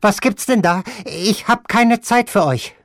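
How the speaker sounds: high, loud, brushing them off; impatient grandmother. Acted. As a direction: wave them aside with no patience. Grandmother voiced in the story.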